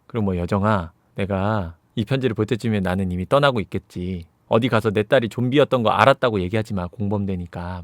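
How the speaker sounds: low, softened farewell reading; subdued letter voice. Acted. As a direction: read the letter quietly and low.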